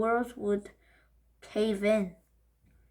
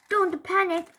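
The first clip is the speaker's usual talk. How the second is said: high, loud call; shout; 8 semitones higher, 4 dB louder than usual. Acted. Paul shouting to calm everyone. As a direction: take charge loudly. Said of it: "shouted Primpy Paul".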